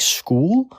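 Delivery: usual speaking voice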